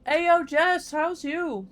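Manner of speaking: talking while chewing; mouth full. Real, not acted. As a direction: speak through a mouthful. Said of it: "Sorry, I'm eating".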